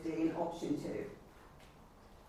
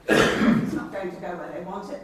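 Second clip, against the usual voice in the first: forceful louder protest; 10 dB louder than usual. Real, not acted. Councillor demanding residents' money back.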